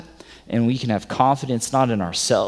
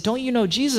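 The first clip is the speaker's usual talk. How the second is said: put-on much higher, light little girl voice; sweet little girl; 12 semitones higher, faster than usual. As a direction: play the innocent girl asking Peter. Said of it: "sweet little innocent girl".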